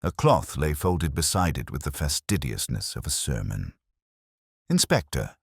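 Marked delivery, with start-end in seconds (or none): 4.7-5.4 puzzled but helpful. A narrator's reading. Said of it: "puzzled but ready to be helpful".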